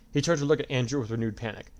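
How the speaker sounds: ordinary delivery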